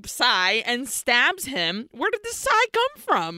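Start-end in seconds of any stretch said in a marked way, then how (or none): 1.9-3.4 very high, baffled squawk; incredulous. Real, not acted. Storyteller baffled by the weapon, high incredulous cry. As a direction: squeal the question in disbelief.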